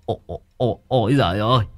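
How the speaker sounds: put-on high frightened yelp of an old man; terrified howl. A narrator's reading. Old Thưởng yelps in fright at something touching him. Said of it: "mồm lão rú lên".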